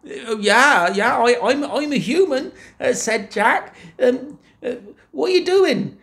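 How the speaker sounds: put-on high, halting frightened boy; nervous stammer. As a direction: stumble over every word.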